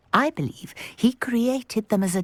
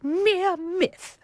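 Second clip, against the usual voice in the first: high, incredulous scoff; amused disbelief; 10 semitones higher than usual. Acted. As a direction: scoff at the idea, pitch jumping up.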